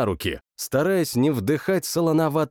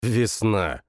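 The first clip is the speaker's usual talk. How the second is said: plain reading voice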